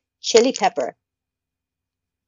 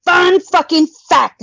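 high, loud fun-fact pitch; excited announcer; 7 semitones higher, 3 dB louder, slower than usual. Real, not acted. Telling Luke a fun fact, high and loud. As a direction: sell it like a TV host.

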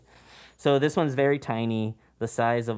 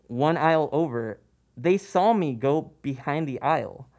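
usual speaking voice

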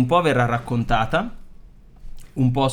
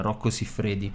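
ordinary talk, nothing marked

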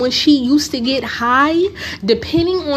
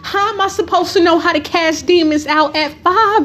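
higher-pitched, exasperated disbelief; indignant comeback; 5 semitones higher than usual. Retold or imitated. Retold five-year-old answering mother, indignant and incredulous. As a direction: protest it in mock outrage, pitched up.

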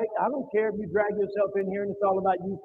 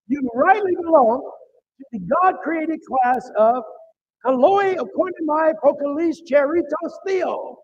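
slow, loud build into a shouted Greek line; preaching climax; 3 semitones higher, 5 dB louder than usual. Real, not acted. Preacher crying out the Greek verse to his church, shouting.

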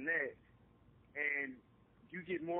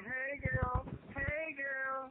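higher, sugary fake greeting to a girl friend; fake friendly mama greeting; 7 semitones higher, much slower than usual. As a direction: imitate the mama gushing over a girl, clearly put-on. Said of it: "when the mama was like".

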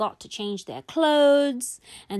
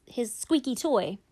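plain reading voice

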